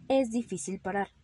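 ordinary delivery

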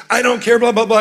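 retold flip-out. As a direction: snap back at her. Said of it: "I flipped out".

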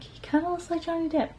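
high, soft little aside; retold private thought. Concertgoer noting a lookalike to themselves, high and hushed. Acting out own thought while watching.

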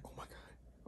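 voiceless oh my God; horrified whisper to himself; stunned whisper. Retold or imitated. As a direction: gasp it out under your breath. Wet pants, caught moment.

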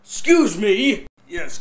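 ordinary talk

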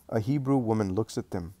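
usual speaking voice

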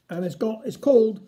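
plain reading voice

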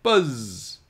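high buzzing fly saying Buzz; buzzy fly voice. A narrator's reading. Fly answering his boy, high and buzzy. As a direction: buzz it like a proud little fly. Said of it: "Fly Guy said".

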